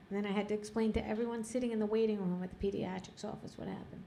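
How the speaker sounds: usual speaking voice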